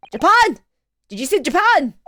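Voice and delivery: high, loud disbelief in mock Irish; loud astonishment. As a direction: blurt it out, astonished, as the governor. Governor startled, acted.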